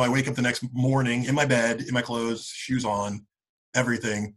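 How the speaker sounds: normal talking voice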